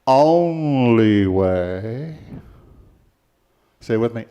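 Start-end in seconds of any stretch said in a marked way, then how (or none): 0.1-2.2 "only" very loud and stretched out long; stress on only. Acted. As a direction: lean hard on "only", dragging it out slowly. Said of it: "he didn't say".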